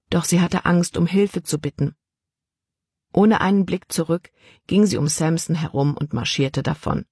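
ordinary delivery

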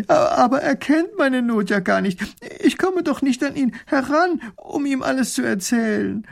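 very high, distraught protest; tearful wail. A narrator's reading. Widow laments to the princess, wailing tearfully. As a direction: wail it in high-pitched despair.

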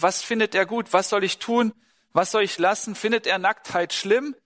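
normal talking voice

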